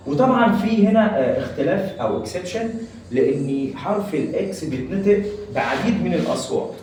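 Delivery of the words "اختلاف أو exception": normal talking voice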